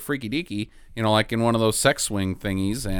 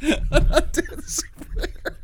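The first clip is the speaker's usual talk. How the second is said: laughing; slower than usual. Real, not acted.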